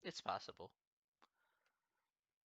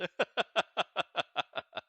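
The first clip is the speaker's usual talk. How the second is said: another fit of laughter, pitched up; real laughter; 7 semitones higher, 6 dB louder than usual. Real, not acted. Teacher still laughing at his British accent. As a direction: keep laughing helplessly.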